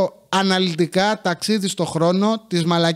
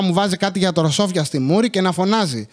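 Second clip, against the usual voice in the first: ordinary delivery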